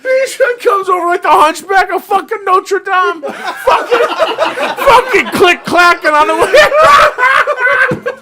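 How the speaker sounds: shrieking through real laughter, very high and loud; crying with laughter. Real, not acted. Storyteller losing it over the hobbling thief, crying with laughter. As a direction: laugh so hard the words come out as shouts. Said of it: "I'm crying right now".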